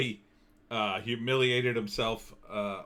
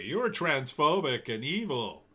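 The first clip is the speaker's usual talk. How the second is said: slow, higher-pitched imitation of accusers; mock accusation; 4 semitones higher than usual. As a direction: lightly mimic an overdramatic online accuser. Imitating fans' accusations on Twitter.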